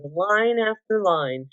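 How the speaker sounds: ordinary delivery, nothing marked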